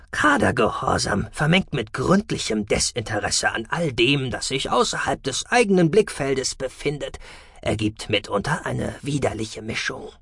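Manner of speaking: leicht verstellt, abfällig; Freundstimme. Acted. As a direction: mit leisem Ekel urteilen. Freund Damalan gesprochen. (leicht verstellte, abfällige Freundstimme)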